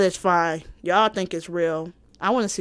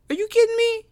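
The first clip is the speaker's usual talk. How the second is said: much higher, shrieked disbelief; incredulous; 3 dB louder, faster than usual. Real, not acted. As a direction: squeal "kidding" in disbelief. Incredulous outburst.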